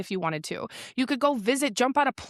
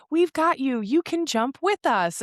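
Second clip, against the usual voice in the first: put-on higher, slowing, encouraging kid voice; little girls' voices; 5 semitones higher, slower than usual. Little girls offering to jump with her, imitated high encouragement. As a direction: imitate small girls coaxing someone along. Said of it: "little girls ... they're like".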